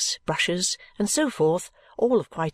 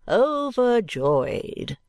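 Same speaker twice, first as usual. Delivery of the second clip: very slow, high and grandiose; effusive dandy; 6 semitones higher, much slower than usual. A narrator's reading. Dandy welcoming visitors, grandly effusive. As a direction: stretch the word out in affected rapture. Dandy gushes over his guests.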